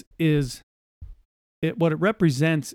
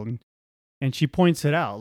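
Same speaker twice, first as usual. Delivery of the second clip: ordinary talk, nothing marked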